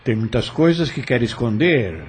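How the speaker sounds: ordinary talk, nothing marked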